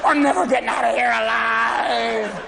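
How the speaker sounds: slow, fake crying wail; mock sobbing. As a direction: blubber the line in mock despair. Paranoid golfer in sand trap.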